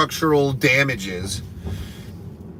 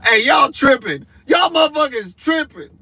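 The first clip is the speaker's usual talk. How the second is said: loud high shout at the room; 10 semitones higher, 4 dB louder than usual. Real, not acted. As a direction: yell it over the crowd. Comic shouting at the crowd.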